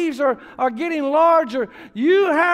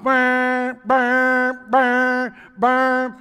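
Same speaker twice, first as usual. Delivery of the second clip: droning alarm buzz voiced on each word; buzzing mock alarm call; imitated alarm; much slower than usual. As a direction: mimic the wave pool siren. Wave pool alarm going off.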